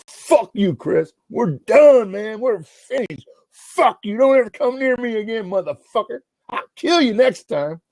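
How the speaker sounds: high-pitched, angry threatening rant; furious threat. Retold or imitated. Friend threatens him once the cops are gone, furiously. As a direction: reenact an enraged friend cutting ties.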